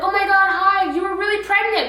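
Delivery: high-pitched acted squeal of a greeting; delighted disbelief, retold in a high voice; excited surprise.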